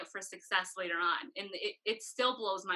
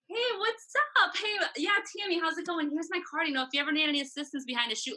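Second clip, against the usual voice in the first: much higher, bubbly acted networking pitch; confident greeting; 7 semitones higher, faster than usual. Photographer handing out her card, acted easy confidence. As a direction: breeze in brightly, then rattle off the offer. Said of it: "you just get so much better".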